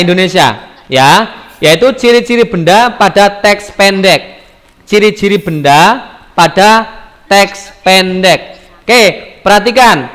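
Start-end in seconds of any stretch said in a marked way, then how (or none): 4.8-8.6 measured dictation-like delivery; deliberate topic repeat. Shown as a demonstration. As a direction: space out "teks pendek" for the class. Teacher repeats the new topic slowly.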